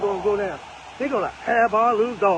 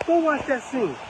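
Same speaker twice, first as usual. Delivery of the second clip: ordinary talk, nothing marked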